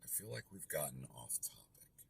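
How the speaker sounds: soft, hushed and even; very calm. Shown as a demonstration. Investor calmly ending a heated call, softly. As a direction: settle them down, gently and quietly. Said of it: "I will very calmly say".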